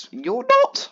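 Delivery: high, loud sudden protest; reassuring a sulking friend with a loud high yelp; insistent yelp. Retold or imitated. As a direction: cut him off, insisting. Urgently contradicting friend's self-pity.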